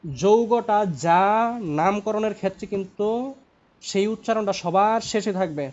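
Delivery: very slow, loud start, deliberate throughout; emphatic repetition. Shown as a demonstration. Teacher repeating a key rule for a student, slow and emphatic. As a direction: spell out the rule with weighty pauses. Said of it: "repeat করতেছি আবার".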